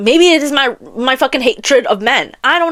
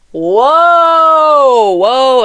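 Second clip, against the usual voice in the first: shocked exclamation; 5 dB louder than usual. Acted. Reacting to narrators moaning in the ear, shocked. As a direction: call a startled halt, stretching the first.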